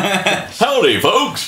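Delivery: joking friend doing a cartoon cowboy; mock cowboy greeting. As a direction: ham up a Western drawl for a laugh. Mocking outsider's idea of accents.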